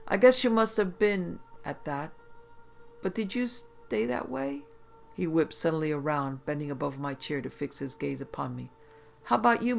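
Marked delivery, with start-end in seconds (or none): 0.1-4.6 sly suspicion. A narrator's reading. Shrewd constable probing. 9.2-9.8 higher, louder sudden challenge; accusing. A narrator's reading. Constable suddenly turns on the magician, higher and louder.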